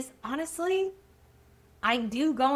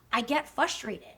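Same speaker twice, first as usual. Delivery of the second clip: ordinary talk, nothing marked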